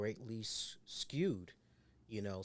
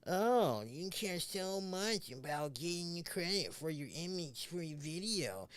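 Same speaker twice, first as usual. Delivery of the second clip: mocking critic; 5 semitones higher than usual. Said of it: "some people will go".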